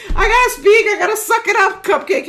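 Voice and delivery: high, loud pep-talk voice; pumped-up self-talk. Retold or imitated. Coach voicing her student's self-pep talk, loud and high. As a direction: psych yourself up, squealing high. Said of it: "I'm like".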